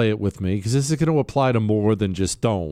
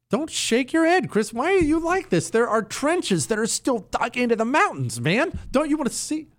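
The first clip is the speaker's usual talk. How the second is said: whiny pleading; 10 semitones higher than usual. Real, not acted. Pleading with producer Chris.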